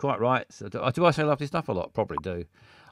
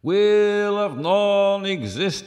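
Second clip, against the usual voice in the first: game show announcer; 10 semitones higher, 7 dB louder, much slower than usual. Pretend. Grand announcement of the wheel.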